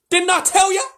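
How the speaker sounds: high, loud told-you-so; triumphant beaver. Acted. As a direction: crow over being right.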